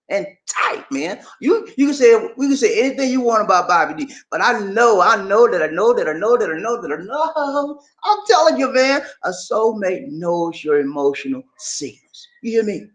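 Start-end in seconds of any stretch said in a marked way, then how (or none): 5.0-7.8 one long rolling run of repeated words; chanted, emphatic certainty; insistent repetition. Real, not acted. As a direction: roll the repeats together like a chant.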